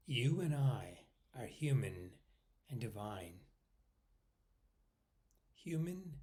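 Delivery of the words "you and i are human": ordinary talk, nothing marked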